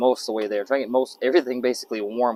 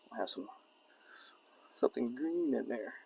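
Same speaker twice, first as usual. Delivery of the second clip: whisper; 7 dB quieter than usual. Real, not acted. Muttering to himself while cooking.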